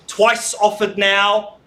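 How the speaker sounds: high, loud, drawn-out call; loud auctioneer call. Real, not acted. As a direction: call it out slowly. Auctioneer calling for bids.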